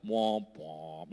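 low mock trombone wah, dropping on the second; sad-trombone womp womp. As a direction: imitate a deflating sad trombone. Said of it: "it would just be like".

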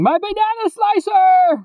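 high, loud overjoyed shriek; screaming. Acted. Reading a review, screams with joy at the door. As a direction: shriek it like the parcel finally came.

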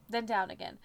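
ordinary delivery

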